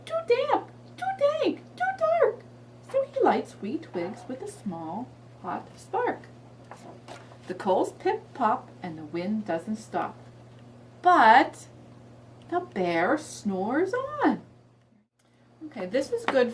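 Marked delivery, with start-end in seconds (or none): 0.1-2.4 very high tiny mouse squeak; squeaky mouse voice. Acted. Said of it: "Mouse squeaks". 2.9-14.4 slow storybook reading; reading to a toddler. Shown as a demonstration. Reads story.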